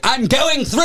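high, pushy drunk-guy impression; drunk bravado. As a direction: barge through like a blackout-drunk friend. Voicing drunk Devin at guard.

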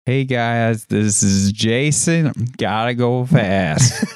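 slow, drawn-out words while hearing yourself delayed; slow delayed-feedback speech. Shown as a demonstration. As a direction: stretch each word against your own echo. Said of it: "you said it slow".

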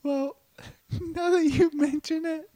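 ordinary talk, nothing marked

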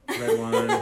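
high laughing punchline. Real, not acted. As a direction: squeal it out through a laugh.

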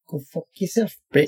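New Zealand accent attempt. Shown as a demonstration. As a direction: keep every word short, kiwi style. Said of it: "keep every single word very short".

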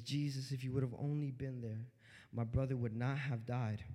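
low, soft retold plea; hushed, low-pitched reproach; subdued retelling. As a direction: quote her quietly and evenly. Mary's words to Jesus, first take.

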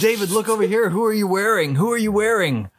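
high, calling paparazzi shout. Pretend. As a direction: yell for a photo like a photographer. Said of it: "sound of paparazzi taking pictures".

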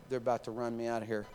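ordinary talk

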